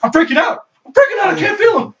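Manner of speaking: rising into a high panicked yell; retold panic. Panicking high kid, quoted.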